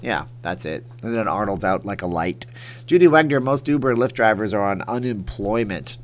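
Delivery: usual speaking voice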